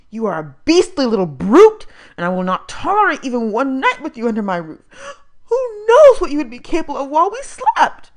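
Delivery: loud, haughty scolding voice; stern foster mother. A narrator's reading. Foster mother to the boy, loud haughty scolding. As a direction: bear down on the boy with icy contempt.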